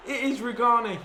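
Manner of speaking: high, loud, ecstatic screaming; building from excitement to full goal roar; goal celebration. Real, not acted. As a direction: scream with joy as it goes in. Celebrating the scorer.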